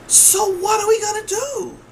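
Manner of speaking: loud, very high-pitched cry; exasperated demand. Retold or imitated. Retells own loud demand to nurse.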